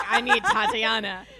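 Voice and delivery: loud retold plea with laughter; mock drunken wail. Drunk woman crying for her friend, mock wail.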